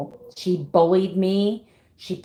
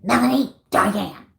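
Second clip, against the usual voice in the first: higher, louder mock mom calling a child in trouble; mom's scolding call; 4 semitones higher, 4 dB louder than usual. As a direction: call the full name like an angry mother. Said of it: "That's how my mom used to call me when I was in trouble".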